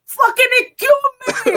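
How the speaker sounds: high, loud imitated yell; Boricua outburst. Wheelchair user trying to get through Times Square, imitated yell. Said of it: "I heard the Boricua come out".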